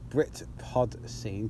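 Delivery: slow, careful Britpod Scene; said slowly. Shown as a demonstration. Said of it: "'Cause I gotta say it slowly".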